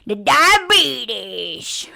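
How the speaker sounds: slow drawn-out country accent on diabetes. Shown as a demonstration. Mocking a rural speaker in a slow country accent. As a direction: stretch The diabetes like a rural Southerner. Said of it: "I'll just say it like this".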